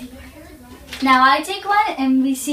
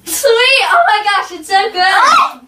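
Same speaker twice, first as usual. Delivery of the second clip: loud delighted cry; thrilled, savoring it; excited; 5 semitones higher, 4 dB louder than usual. Real, not acted. Gets the sweet bean.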